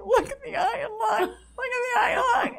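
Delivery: high squeal, re-enacting a laughing fit; mock-hysterical, high-pitched giggling cry; helpless laughter. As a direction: squeal it as if you can't stop laughing. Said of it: "'cause I'm laughing".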